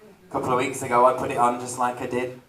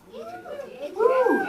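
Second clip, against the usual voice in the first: excited whoop; 6 dB quieter than usual. Real, not acted. Cheering on musical theatre.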